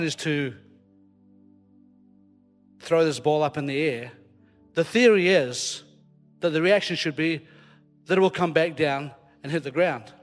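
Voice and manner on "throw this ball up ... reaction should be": normal talking voice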